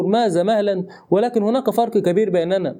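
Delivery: ordinary delivery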